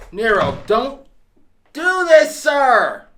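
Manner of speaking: high, loud outburst of dismay; loud, high-pitched pleading; shouted plea. Real, not acted. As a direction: yell at the screen, begging them to stop. Viewer pleads with character on screen.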